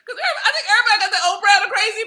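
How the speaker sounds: laughing fast and loud through the words. Real, not acted. Laughing to her audience about her crazy. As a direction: giggle it out, high and quick.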